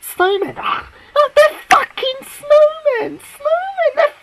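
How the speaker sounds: high, loud, half-crying, half-laughing shriek; shrieking rant. Real, not acted. Fan wailing at the screen over snowmen, high half-laughing shriek. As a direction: wail it out in real disbelief.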